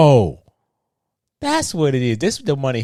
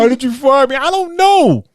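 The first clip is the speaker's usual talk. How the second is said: mock whiny teen; 11 semitones higher, 4 dB louder than usual. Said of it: "quoting movie character".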